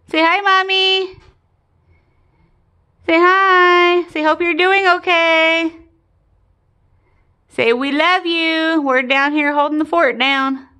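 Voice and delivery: high, slow pet talk; talking to a dog. Real, not acted. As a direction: coax the dog sweetly, slowly. Voicing message for pet.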